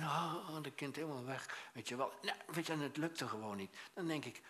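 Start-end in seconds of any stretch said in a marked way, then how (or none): none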